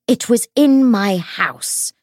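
high, loud, slow angry hiss. A narrator's reading. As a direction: force it out slowly in fury. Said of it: "she hissed".

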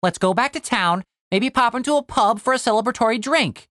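high, coaxing sidekick; Tim, upbeat. Acted. Tim suggests a pub to Cooper, coaxing.

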